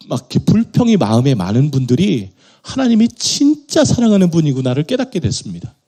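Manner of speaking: ordinary delivery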